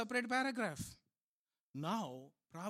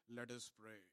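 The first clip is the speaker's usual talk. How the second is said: much softer, pious husband; solemn husband; 9 semitones lower, 14 dB quieter than usual. Acted. Husband kneeling by the bed, softly pious. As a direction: kneel and murmur it devoutly. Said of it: "he kneels down and say".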